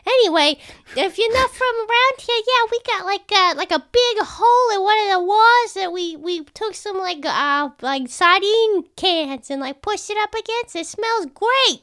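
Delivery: very high chatty rat; squeaky rat voice. Acted. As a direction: prattle like a friendly rat. Rat NPC explains home.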